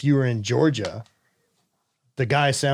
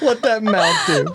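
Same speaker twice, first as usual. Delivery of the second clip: playful sassy taunt; 8 semitones higher, 4 dB louder, slower than usual. Acted.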